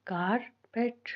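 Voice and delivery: Carpet as कार, long pause, पेट; split into syllables. Shown as a demonstration. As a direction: say carpet slowly in two separate beats. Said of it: "syllables हम यहाँ पे कैसे find".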